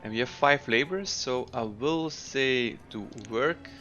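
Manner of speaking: ordinary delivery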